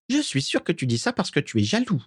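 put-on high, coy ghost-girl voice; simpering. A narrator's reading. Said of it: "minauda Mimi". (minauderie aiguë)

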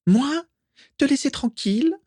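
very high, squeaky indignation; indignant. A narrator's reading. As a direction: protest in a piercingly high ghost-girl voice. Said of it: "s'indigna Mimi". (voix suraiguë et indignée)

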